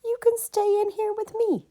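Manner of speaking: high squeaky little mouse; a tiny mouse offering shelter, very high; small mouse voice. Acted. Mouse offering shelter to the geese, high and small.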